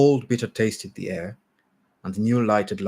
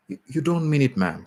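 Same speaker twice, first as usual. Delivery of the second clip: pained; 6 semitones higher than usual. Acted. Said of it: "There was pain in her voice".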